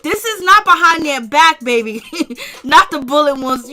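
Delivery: ordinary delivery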